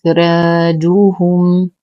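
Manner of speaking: إخراجهم with a heavy خ and a light ر, slowly; slow tajweed recitation. Shown as a demonstration. Teacher recites the word for students, slow measured chant. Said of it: "ترقق الراء قولا واحدا".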